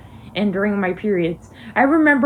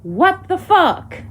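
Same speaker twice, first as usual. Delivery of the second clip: outraged; 8 semitones higher, slower than usual. Real, not acted. Disbelief at being ignored.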